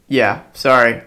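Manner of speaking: ordinary delivery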